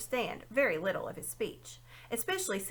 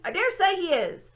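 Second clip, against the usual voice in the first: put-on loud, high old dwarf grumbling and reminiscing; a cranky old dwarf lecturing the owl; grumpy old dwarf; 4 semitones higher, 8 dB louder than usual. A narrator's reading.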